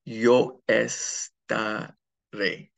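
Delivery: slow, careful Spanish estaré with the stress on the final é; Spanish future form. Shown as a demonstration. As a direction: say "Yo estaré" slowly, landing on the final "ré". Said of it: "on the ending A".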